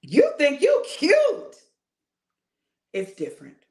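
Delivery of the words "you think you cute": much higher and louder, slow, cheering; high, delighted hype; playful admiring. Shown as a demonstration. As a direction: lift it up like a compliment.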